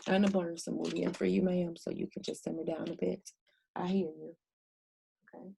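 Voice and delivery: soft, calming a restless spirit; soothing. Real, not acted. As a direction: settle her down gently.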